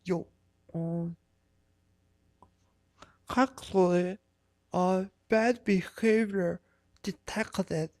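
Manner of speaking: slow, prissy mock-officious voice; imitating a hall monitor troll, slow and fussy. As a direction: parody a self-righteous snitch. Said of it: "it's like".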